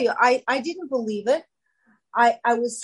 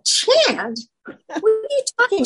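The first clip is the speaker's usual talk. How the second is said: high-pitched retold disbelief; mock incredulous; 12 semitones higher than usual. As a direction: act out baffled disbelief, voice jumping way up. Retelling her puzzled reaction to advice.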